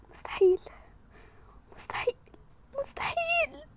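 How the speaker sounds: soft, high, crying disbelief; tearful whisper. Real, not acted. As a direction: whisper it through tears.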